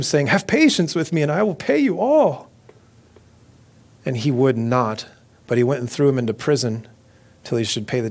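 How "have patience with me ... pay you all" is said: high-pitched plea, read aloud; begging. A narrator's reading. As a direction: plead for more time, voice climbing high. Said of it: "fell down at his feet and begged him".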